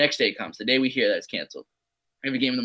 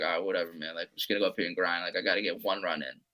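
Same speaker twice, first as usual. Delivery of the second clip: muttered self-talk; 6 semitones lower, 8 dB quieter, faster than usual. Retold or imitated. Retold self-talk before at-bat.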